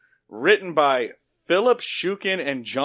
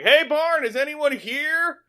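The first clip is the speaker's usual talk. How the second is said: calling out; 11 semitones higher, 3 dB louder, slower than usual. Retold or imitated. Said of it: "he's like".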